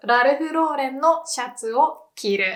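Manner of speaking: "Ralph Lauren" as "rarufu rōren" inside a Japanese sentence; Japanese pronunciation. Shown as a demonstration. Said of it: "Japanese people would just pronounce".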